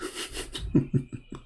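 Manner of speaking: soft wheezy chuckle, real; real laugh trailing off. Real, not acted. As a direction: break into quiet, helpless laughter. Amused by his own story.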